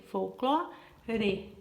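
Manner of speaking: "folclore" split with the final re set apart; "folclore" in separate syllables, fol-clo-re. Shown as a demonstration. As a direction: sound out "folclore" slowly, pausing before the last syllable. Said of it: "Sílaba final".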